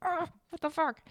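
high, disgusted ugh; fed up with the weather; exasperated what the fuck; exasperated groan. Real, not acted. Annoyed at snow in Chicago.

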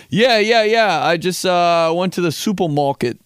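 put-on "supermarket" mispronounced like a kid who can't say R's; fourth grade slip-up. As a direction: say "supermarket" with babyish W-like R's, brushing on. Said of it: "or being like".

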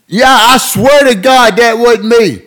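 high, loud, slow hammed-up swearing; mock solemn oath. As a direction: drag out the sacred promise. Buddy repeats the oath.

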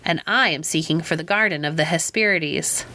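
plain reading voice

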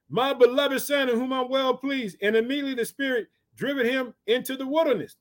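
high, held pulpit reading tone; scripture declamation. Acted. Preacher quotes the verse to his church, high declamation. Reads scripture aloud to congregation.

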